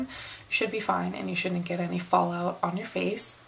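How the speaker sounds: ordinary delivery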